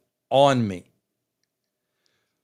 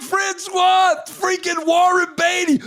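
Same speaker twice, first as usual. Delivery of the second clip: excited yell; 14 semitones higher than usual. Retold or imitated. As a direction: yell his name, stunned by who it is. Passenger yelling at driver.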